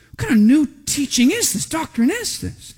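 high-pitched wonder, slowing to a drawn-out end; amazed. Retold or imitated. Amazed people in the synagogue seeing demons obey, astonished. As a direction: voice the crowd's astonishment, pitched well up. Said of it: "it says they're amazed".

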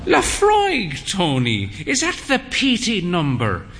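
a frail dame asking archly; high arch old mother's question. Acted. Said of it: "he said".

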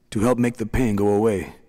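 plain reading voice

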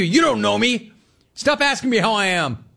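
high, exasperated snapping; mock irritation at small talk; annoyed. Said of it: "riffing as annoyed customer".

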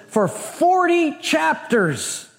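forty stretched slowly and high; stress on forty. Real, not acted. Preacher to congregation stressing forty, drawn out and high. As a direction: lean hard on forty. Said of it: "emphasis on forty".

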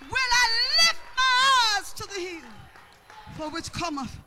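high, loud, slow shouted praise; full-force preaching. Real, not acted. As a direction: holler each phrase and stretch the last word.

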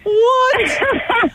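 high shocked cry. Real, not acted. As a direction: squeal it in disbelief. Host shocked by wedding vows.

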